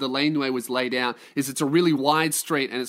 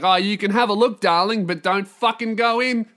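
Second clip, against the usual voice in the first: louder, higher mock warning; exasperated boyfriend; 4 semitones higher, 4 dB louder than usual. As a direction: warn a partner off, half pleading. Acts himself warning his girlfriend.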